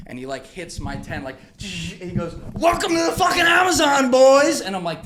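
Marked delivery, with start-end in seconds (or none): 2.5-4.6 higher, louder hearty shout; booming gleeful call, much higher and louder; mock announcer shout. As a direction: yell a gleeful welcome. Paul bangs tent, shouts.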